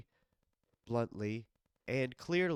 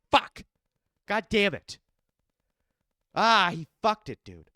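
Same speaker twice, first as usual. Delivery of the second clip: loud, exasperated swearing burst; sudden angry outburst of swearing; frustrated cursing; 6 semitones higher, 10 dB louder than usual. Real, not acted. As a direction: let the curses explode out in real frustration. Reacting to his fighter losing.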